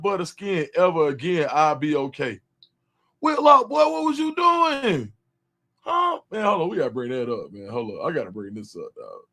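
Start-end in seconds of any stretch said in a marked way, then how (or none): 3.2-6.2 high, slow mocking drawl; teasing callout. Real, not acted. Streamer roasting Whitlock to his chat, high mocking call. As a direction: stretch it out to roast him.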